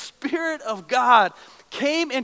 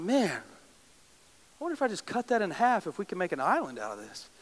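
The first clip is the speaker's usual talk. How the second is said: softer, scheming half-thought; musing to himself; 4 semitones lower, 8 dB quieter than usual. Retold or imitated. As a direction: hatch a silly idea under your breath. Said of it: "And I'm like".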